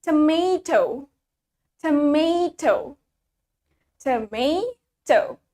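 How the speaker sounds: tomato very slow, tuh-MAY-toh with a clear t; Canadian pronunciation. Shown as a demonstration. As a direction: model "tomato" syllable by syllable, crisp t. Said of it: "in Canadian accent, we say".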